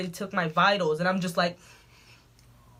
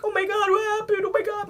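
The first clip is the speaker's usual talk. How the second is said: high, alarmed mock mother; a lightly voiced, much higher worried mom; 13 semitones higher than usual. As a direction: play the shocked mother. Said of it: "My mom's like".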